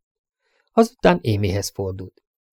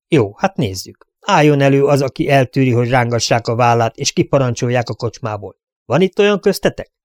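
a lawman pressing for a straight answer, a touch raised; stern sheriff questioning; 2 semitones higher than usual. Acted. Sheriff grilling the crowd about who drew first, stern. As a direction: demand the truth like a sheriff at a hearing.

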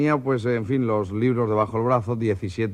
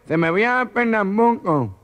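higher, slow, garbled nasal reply; Paco's mock nasal voice; 9 semitones higher, slower than usual. Paco the warehouse man answers in a slow, garbled nasal voice. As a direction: answer like a gangoso. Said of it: "habla como este tío".

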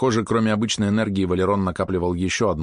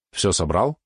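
usual speaking voice

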